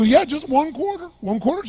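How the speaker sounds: put-on high, strange voice of a pleading drunk; bizarre drunk voice. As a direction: whine it up high like the beggar. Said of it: "this really bizarre looking sounding voice".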